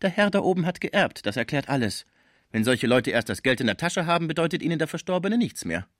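lightly voiced gossiping concierge; spiteful gossip. A narrator's reading. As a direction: sneer at the heir.

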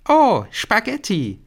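put-on high, drawn-out girlish wonder; exaggerated amazement. A narrator's reading. Girl at lunch sees spaghetti as if for the first time, amazed. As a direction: marvel slowly in a high voice. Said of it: "als hätte sie noch nie welche gesehen".

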